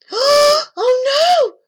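high, loud alarmed cry; sudden alarm. A narrator's reading. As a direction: blurt it out in shock. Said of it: "said Kieran suddenly".

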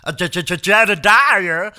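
loud, high stammering Jedediah accent. Shown as a demonstration. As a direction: stammer into the name, then stretch it out loud in a mock southern accent. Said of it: "do your fucking Jedediah accent".

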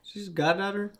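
high, shocked question; can't believe it; surprised. Real, not acted. Shocked by the news.

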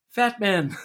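high loud put-on voice; mock voice. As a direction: bark it out in a silly voice. Mocking a voice, laughing.

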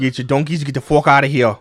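broad fake Boston bluster; terrible Boston accent. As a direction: do a pushy, bad Boston accent. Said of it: "terrible Boston accents".

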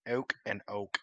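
"Oak and oak" with two different o vowels; change the vowel between the first and second oak; contrasting pronunciations. Shown as a demonstration. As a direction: show two regional ways of saying oak. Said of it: "if I could do the oak and oak".

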